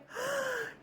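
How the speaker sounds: mock gasp. Mock shock at swollen hand.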